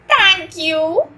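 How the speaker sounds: high, loud grateful thanks. A narrator's reading. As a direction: thank him joyfully. Elliot grateful hug.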